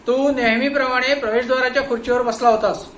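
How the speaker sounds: loud, high interrogator's voice; stern questioning. Acted. Inspector grilling the watchman, loud and stern. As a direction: press him hard like a cop.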